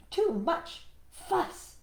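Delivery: high, slow sighing rabbit; weary Rabbit. Acted. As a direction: sigh it out wearily. Rabbit's thought.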